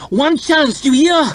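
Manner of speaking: very high, strained urgent voice; desperate harsh order. A narrator's reading. Rescuer pulling fallen man to his feet, harsh and desperate. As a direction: urge him up, high and forceful.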